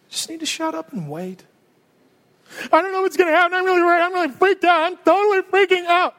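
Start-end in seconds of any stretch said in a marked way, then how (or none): 2.7-6.1 higher, louder mock fretting; mock panic. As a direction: whine like a panicking man. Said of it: "worried Zechariah voiced".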